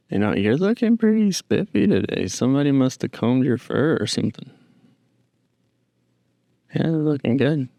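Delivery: pet talk. Real, not acted. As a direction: fuss over the pet in a high sweet voice. Cooing compliments to the pet.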